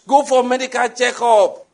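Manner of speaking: high, loud mock exhortation; imitated zealous preacher. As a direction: cry it out like an overzealous preacher. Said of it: "talking with a lot of zeal".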